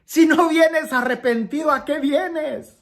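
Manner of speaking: high-pitched scolding, imitating Padre Pío; shouted rebuke. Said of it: "él gritaba".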